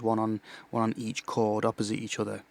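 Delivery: normal talking voice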